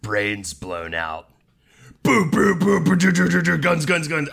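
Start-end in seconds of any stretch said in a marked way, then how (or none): 2.0-4.3 gunfire sound effects. Pretend. Mimicking gunfire while describing comic.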